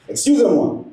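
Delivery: a mild, high call for attention; imitated holler. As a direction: imitate a bus driver calling out for the fare. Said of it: "the bus driver hollering at me".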